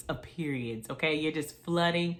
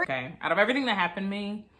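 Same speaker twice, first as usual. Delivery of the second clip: ordinary delivery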